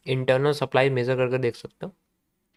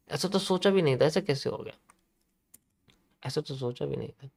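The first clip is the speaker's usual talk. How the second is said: mock disbelief fading to near silence; 8 dB quieter than usual. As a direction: let the shocked murmur die away. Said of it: "buyers कहेंगे".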